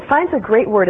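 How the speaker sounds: ordinary delivery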